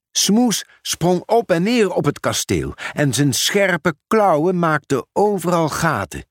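ordinary delivery, nothing marked

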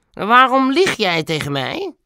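slow, high-pitched cross demand; grumbling accusation. A narrator's reading. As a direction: drag out the accusation in a put-on voice. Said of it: "bromde meneer Moeial". (langzame, hoge, mopperende beschuldiging)

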